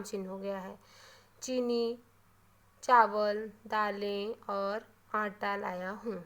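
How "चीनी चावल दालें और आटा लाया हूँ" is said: slow, word by word. Shown as a demonstration. Teacher demonstrating where commas go, slow and word by word. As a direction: list it slowly, one word at a time. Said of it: "हम धीरे धीरे बोल रहे हैं".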